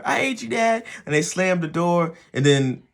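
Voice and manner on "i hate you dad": put-on much higher pitched sulky kid voice; bratty kid. Kid snapping back at the parent, high mock whine. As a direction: whine it like a resentful teenager.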